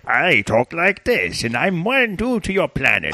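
slow put-on alien voiceover; hammed-up alien voice; mock voiceover voice. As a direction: drawl it like a cartoon alien. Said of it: "the voices that these guys were doing were like".

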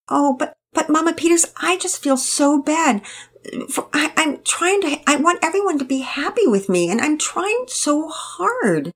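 put-on high, eager-to-please girl rushing on; tired young girl. A narrator's reading. Penelope explains herself to Mama Peters, high and earnest. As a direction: plead earnestly, a bit flustered.

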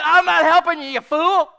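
high, very loud refusal; shouted retort. Retold or imitated. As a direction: yell back in a retold argument. Shouted retort to screaming Vicky.

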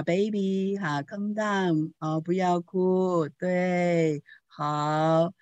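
put-on soft, slow hush to a baby; calm the baby down gently; soothing. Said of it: "就好像在那里安抚一样".

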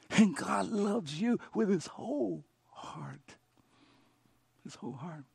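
soft, slow whispered assurance; a hushed, intimate reassurance; tender whisper. Real, not acted. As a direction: whisper it like a secret to one person. Tender hush after preaching.

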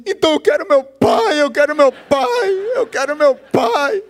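high, whining little girl voice, laughing into a fake cry; child's whiny demand. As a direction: play the clever girl who now wants her dad. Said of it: "ela olhou para a mãe e disse assim".

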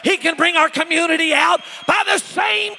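high, shouted preaching, words hammered out; full-force preaching. Acted. Preacher rousing the church with God's power, full-force preaching. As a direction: shout it like a revival preacher at the climax.

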